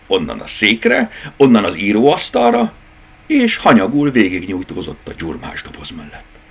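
plain reading voice

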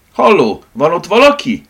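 loud, high impatient call; irritated woman demanding an answer. Acted. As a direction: call sharply down a phone line, annoyed. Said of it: "hallotta távolról a lány ingerült hangját".

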